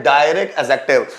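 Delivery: ordinary talk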